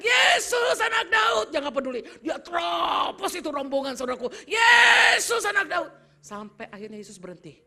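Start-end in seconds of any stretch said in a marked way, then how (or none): 0.0-1.5 high, loud cry; desperate shout. Acted. Mother still shouting to Jesus. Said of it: "dia terus berteriak". 1.5-4.3 loud, driven preaching. Real, not acted. Preacher caught up telling the story. 4.5-5.9 high, loud cry; desperate shout. Acted. Mother still shouting to Jesus. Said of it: "dia terus berteriak".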